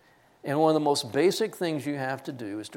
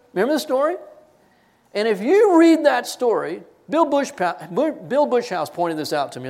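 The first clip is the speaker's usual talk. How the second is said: raised, loud preaching about self-righteousness; fervent sermon climax; full-force preaching; 7 semitones higher, 8 dB louder than usual. Acted. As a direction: press it on the congregation with rising force.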